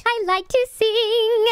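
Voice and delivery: put-on sing-song girl voice. Contestant explaining her voice.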